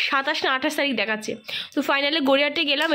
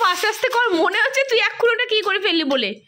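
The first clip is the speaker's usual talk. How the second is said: raised, high-pitched telling-off; exasperated scolding; 8 semitones higher, 8 dB louder than usual. Real, not acted. Scolding over-eager child while unwrapping.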